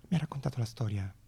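usual speaking voice

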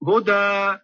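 ordinary delivery

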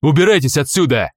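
loud angry get out; harsh order to leave. Acted. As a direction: drive them off with a shout. Guard orders them away.

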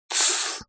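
soft, voiceless "shh"; shushing. A narrator's reading. Hero orders the boy to keep silent, shushing. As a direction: hush someone sharply under the breath. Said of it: "приказал я пацану молчать".

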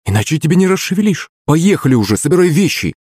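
high-pitched, insistent nagging; bossy goading. A narrator's reading. Countess pushing her husband to pack, nagging.

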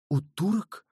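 high, astonished question; startled exclamation. A narrator's reading. Raoul learns his guardian is among Turks, astonished. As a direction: exclaim in disbelief, pitch jumping up. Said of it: "воскликнул Рауль".

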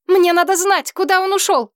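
high frantic demand; desperate shout. A narrator's reading. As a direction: shout it up in despair. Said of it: "в отчаянии крикнула".

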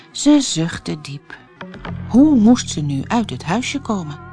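ordinary delivery

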